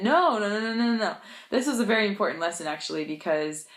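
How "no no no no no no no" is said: higher, louder string of no's; emphatic correction. Retold or imitated. Friend rejecting learner's pronunciation, emphatic denial. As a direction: imitate a friend firmly cutting in to correct.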